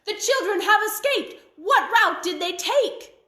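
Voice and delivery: high, loud witch crying out to the birds; witch calling to birds. A narrator's reading. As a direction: shout up to the birds in a panic. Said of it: "she called to the birds".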